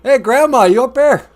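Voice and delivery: loud, high pretend holler to Grandma; calling out. Calling for grandma up in heaven, loud calling out. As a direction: shout up to someone far away.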